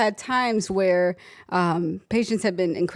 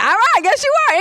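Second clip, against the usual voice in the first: much higher and louder, amused comeback; sassy comeback; 8 dB louder, faster than usual. Retold or imitated. Nurse answers the grudging patient, high and sassy. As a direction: toss it back with a grin. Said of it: "I was like".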